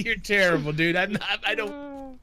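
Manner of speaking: ordinary delivery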